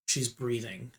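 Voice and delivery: ordinary delivery, nothing marked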